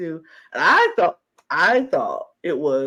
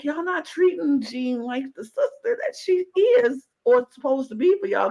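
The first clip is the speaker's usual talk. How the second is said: high, indignant call-out; sassy call-out; 9 semitones higher than usual. Retold or imitated. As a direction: scold them with attitude. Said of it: "And I was like".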